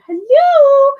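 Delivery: high, loud, long-held greeting; sing-out holler of greeting; shouted hello. Real, not acted. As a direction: call out hello at full volume. Calling a greeting to viewer.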